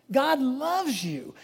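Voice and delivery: slow, tender "God loves you"; tender emphasis. Real, not acted. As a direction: let it land slowly, gently.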